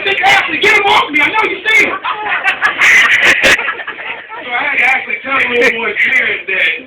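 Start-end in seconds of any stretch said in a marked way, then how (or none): 0.0-1.9 put-on high, loud panicked boy; kid screaming. Boy begging the teacher to pull him off, panicked scream. 2.0-4.3 loud high laugh; laughter. Real, not acted. Comedian laughing at his own story, loud laugh.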